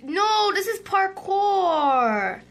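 high, stretched-out complaint; dismayed whine. Real, not acted. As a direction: whine it, dragging out the last word. Dismayed at the parkour map.

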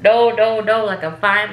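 retold insistence. Insisting to nurses she's fine.

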